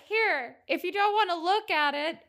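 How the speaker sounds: high mimicked offer. Betty offers Ethel tape, mimicked.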